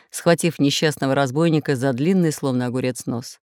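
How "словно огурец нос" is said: ordinary delivery, nothing marked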